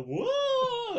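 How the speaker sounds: long high ghostly wail; spooky drawn-out whoa like a phantom. Retold or imitated. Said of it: "ghosts that just show up and go".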